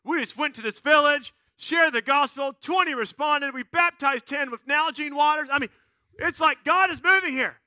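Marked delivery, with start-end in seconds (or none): 0.0-5.5 loud, high excited report; mission-trip high. Acted. Team member back from a mission trip, thrilled. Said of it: "you get that high, right?". 6.2-7.6 high, loud and thrilled; mission-trip high. Acted. Returning mission tripper telling friends, elated. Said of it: "you get that high, right?".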